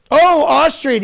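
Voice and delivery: loud, high burst of approval; excited delight. Acted. Host hearing the viewer is Austrian, excited. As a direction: exclaim it with showy delight.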